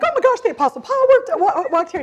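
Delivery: high, loud excited squeal over Ephesus; excited gush. Retold or imitated. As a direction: gush like a thrilled pilgrim. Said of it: "I was a little more excited".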